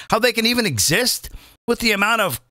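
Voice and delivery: ordinary talk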